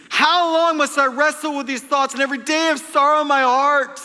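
high, loud, impassioned recitation; re-enacting shouting scripture alone on the couch; screaming the psalm. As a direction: cry the verses out with all you've got. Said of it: "And I'm screaming this".

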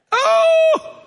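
sudden high-pitched startled oh; a sharp jump of surprise; surprised oh. Real, not acted. Sudden exclamation mid-verse.

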